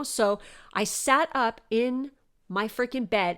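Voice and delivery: usual speaking voice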